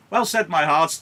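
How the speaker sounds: high, loud party cheer; rally the guests, loud and jolly; loud hearty host. Acted. Capulet turning to his party guests, loud and hearty.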